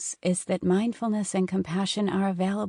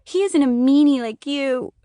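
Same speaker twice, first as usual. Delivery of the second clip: put-on much higher and louder tearful child; crying child; 8 semitones higher, 8 dB louder than usual. A narrator's reading. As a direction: wail it at mom through tears. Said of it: "Sophie cried".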